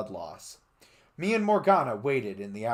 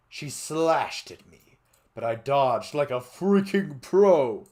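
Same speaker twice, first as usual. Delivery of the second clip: dramatic action; 4 semitones higher, 9 dB louder than usual. A narrator's reading. Action narration.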